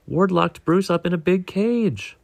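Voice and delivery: high-pitched urgent report. A narrator's reading. Nemo tells the other fish his friend is trapped, urgently. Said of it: "Nemo said urgently".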